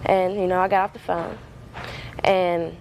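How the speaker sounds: normal talking voice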